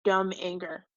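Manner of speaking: ordinary delivery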